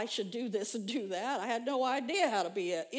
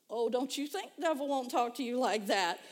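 normal talking voice

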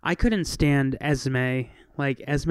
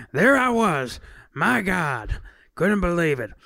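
Al Pacino; 2 semitones higher than usual. Pretend. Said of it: "I wanted to read it as Pacino".

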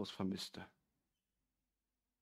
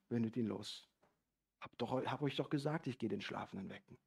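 matter-of-fact; 4 semitones lower, 8 dB quieter than usual. Acted. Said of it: "als ob es das Normalste der Welt wäre".

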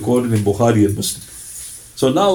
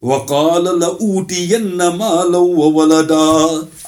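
brisk chanted Quran recitation, a little higher; 4 semitones higher, much faster than usual. Acted. Preacher recites the verse to the congregation, chanted. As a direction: intone the verse in Arabic recitation style.

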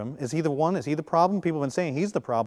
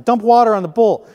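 bold command; 7 semitones higher, 10 dB louder than usual. Acted. Elijah commands a soaked altar.

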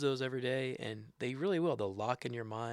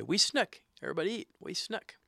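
raised, high call to a gathering; calling everybody to eat; 8 semitones higher than usual. Retold or imitated. After the elder's prayer at a gathering, calling everyone to eat. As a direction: imitate the announcement that the meal starts, pitched up. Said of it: "right after that they'll say".

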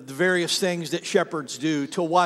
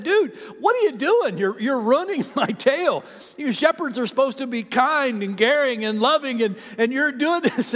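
indignant confrontation; 6 semitones higher than usual. Retold or imitated. Tour guide confronting the shepherd.

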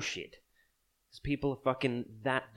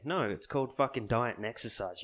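usual speaking voice

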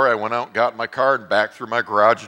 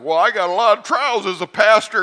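complaining; 11 semitones higher, 8 dB louder than usual. Retold or imitated. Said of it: "I'm like".

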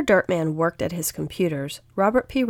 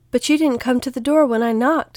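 higher-pitched, mildly sheepish protest; slightly raised, defensive little voice; 7 semitones higher, 5 dB louder than usual. A narrator's reading. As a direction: make a small excuse after a scolding. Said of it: "a little sheepishly".

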